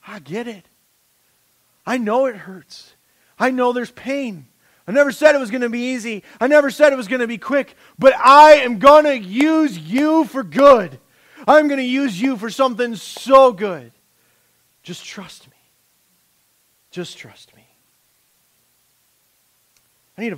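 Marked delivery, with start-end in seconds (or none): 0.1-13.9 God speaking. Retold or imitated. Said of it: "And God can go". 14.8-17.7 gentle plea. Retold or imitated. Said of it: "And God can go".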